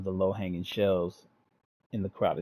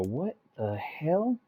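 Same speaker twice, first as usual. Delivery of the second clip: stunned; 7 semitones higher, much slower than usual. Real, not acted. Reader reacts to gore.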